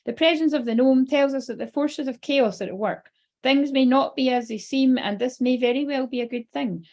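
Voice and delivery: emphatic read-aloud phrasing. Real, not acted. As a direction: deliver written guidance to listeners. Reads card text aloud.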